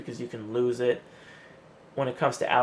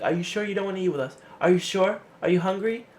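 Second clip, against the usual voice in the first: high, fast, insistent nagging; pestering coworkers; 6 semitones higher, faster than usual. Retold or imitated. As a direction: badger him to come eat. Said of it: "it's annoying because they'll be like".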